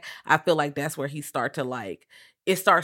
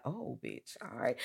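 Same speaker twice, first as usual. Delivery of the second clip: much softer, lower under-the-breath reaction; hushed aside; 4 semitones lower, 14 dB quieter than usual. Retold or imitated. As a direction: say it under your breath, shocked. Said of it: "she's like".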